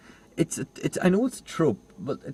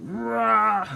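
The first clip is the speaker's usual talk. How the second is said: mock roar; 10 semitones higher than usual. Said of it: "Be like".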